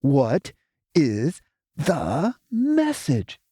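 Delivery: very slow, deliberate angry probe; slow angry probing question. Acted. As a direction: drag each word out, menacing like Tregtar. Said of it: "speaking slowly and angrily like Tregtar".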